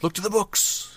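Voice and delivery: high-pitched mad scientist outburst; Doc Brown. Acted. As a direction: pitch it way up like an excitable movie scientist. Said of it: "Doc McFly comes out and goes".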